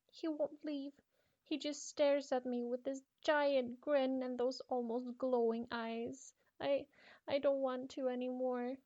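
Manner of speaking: high, slow, shaky dread; slow frightened telling of the grin; terrified girl. Acted. Frightened girl describes grinning man.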